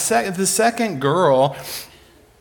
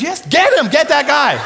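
high, raised, excited yell; gleeful command; 9 semitones higher, 4 dB louder than usual. Retold or imitated. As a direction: shout it eagerly as an acted recruiter. Jesus eagerly picking misfit recruit.

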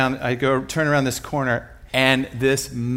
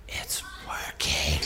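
slow, unvoiced stage whisper; comic awed whisper of a hiding child; hushed whisper; 10 semitones lower, 10 dB quieter, slower than usual. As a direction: whisper it in secret wonder, drawn out.

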